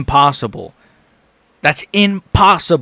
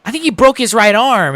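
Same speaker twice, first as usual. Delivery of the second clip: higher mock commentator voice, imitating Rogan; 8 semitones higher, 4 dB louder than usual. Streamer mocking Rogan's commentary, mock announcer. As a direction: parrot the announcer's call, lightly hammed. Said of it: "is what Rogan is saying".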